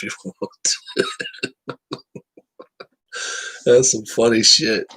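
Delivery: amused, breaking into a laugh. Real, not acted. Cracking up at his own joke about money, laughing. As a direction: talk through your own laughter.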